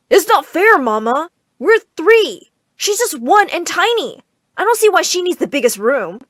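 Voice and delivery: loud, high whiny protest; protesting boy. Acted. Brother protesting to Mama about the room, loud and whiny. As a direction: complain loudly to Mama. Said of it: "tried to protest".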